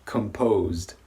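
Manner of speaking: composed ending on a clearly voiced D; voiced D demo. Shown as a demonstration. Teacher showing the voiced ending to learners. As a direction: buzz the final D in composed. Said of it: "we're gonna have a voiced sound there".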